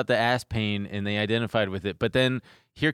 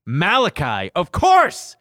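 sudden realization; 4 dB louder, slower than usual. Retold or imitated. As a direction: realize it all at once. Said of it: "I was like".

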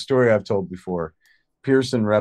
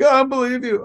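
high, loud stubborn disbelief; imitating his girlfriend; disbelieving girlfriend; 6 dB louder, faster than usual. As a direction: refuse to believe him. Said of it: "a hysterical Jennifer Schwalbach".

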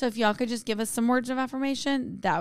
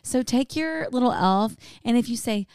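normal talking voice

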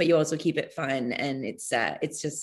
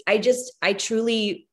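normal talking voice

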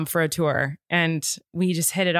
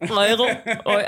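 imitated Northern Irish accent; 3 semitones higher, 3 dB louder than usual. Podcast host echoing her Irish guest's word, mock Northern Irish accent. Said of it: "sounds like a, a throat gurgle".

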